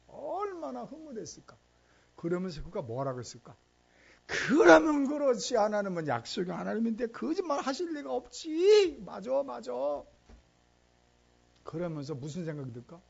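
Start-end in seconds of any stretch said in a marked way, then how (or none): 4.2-9.7 joyful relief. Acted. Isaac rejoicing over twins.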